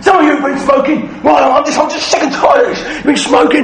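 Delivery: high, loud scolding rant at the pupils; hammed-up furious teacher; angry telling-off. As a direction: lay into the kids, shouting. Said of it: "literally just laid into them".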